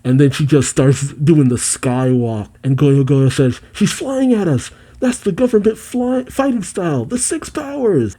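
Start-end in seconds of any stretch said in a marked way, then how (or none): 3.7-8.2 high-pitched acted cry of alarm; mock alarm. Goyo Goyo warns the crew of Alpha's skywalk, mock alarm.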